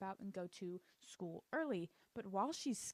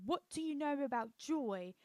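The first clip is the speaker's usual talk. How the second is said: higher, mock-accusing British accent; 5 semitones higher, 4 dB louder than usual. [name] confronting Nina at dinner, accusing British accent. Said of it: "I keep doing that accent".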